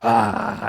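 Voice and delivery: a loud pumped-up ah; imagined client screaming down the phone; mock scream. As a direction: let out a hyped scream over club music. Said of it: "we'd hear you screaming".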